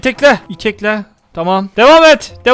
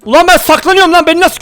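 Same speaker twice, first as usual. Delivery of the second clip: louder, higher outburst; exasperated shout; 7 semitones higher, 3 dB louder than usual. Real, not acted. Gamer spotted while hiding, shouting in disbelief. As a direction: yell it in disbelief.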